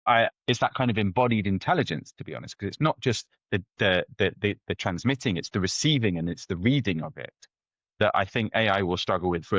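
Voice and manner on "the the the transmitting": ordinary talk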